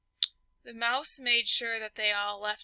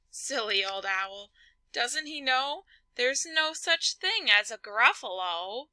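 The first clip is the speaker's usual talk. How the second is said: ordinary delivery, nothing marked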